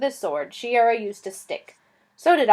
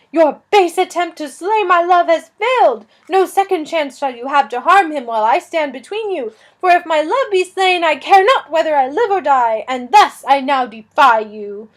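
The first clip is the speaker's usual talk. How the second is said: higher, loud, grand theatrical defiance; defiant princess; 5 semitones higher, 7 dB louder than usual. Acted. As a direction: stand your ground in lofty old style. Princess defies the dragon.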